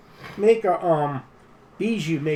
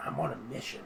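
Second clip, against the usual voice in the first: secretive; 10 dB quieter, faster than usual. Acted. Traveler confiding his mission to pawns, secretive. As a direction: drop your voice, let them in on it. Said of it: "slow and, and secretive".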